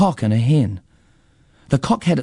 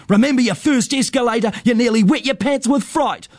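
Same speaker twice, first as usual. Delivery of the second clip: high, mocking jeer; boy needling his rival in a high voice; yelled taunt; 9 semitones higher than usual. A narrator's reading. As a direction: taunt him with raised pitch. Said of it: "he yells".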